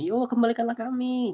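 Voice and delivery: high-pitched mock plea; pleading. The damned beg God to send them back, pleading. Voices the damned begging to return.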